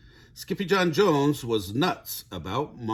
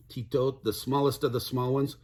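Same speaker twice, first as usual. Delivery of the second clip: ordinary delivery, nothing marked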